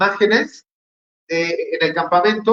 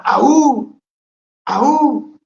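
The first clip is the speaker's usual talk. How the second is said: high, loud barked war cry; Spartan war cry; 8 semitones higher, 4 dB louder than usual. Retold or imitated. Preacher imitating the Spartans' war cry to wake up the congregation. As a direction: bark "Aú" twice like a whole Spartan army. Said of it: "Y gritaban".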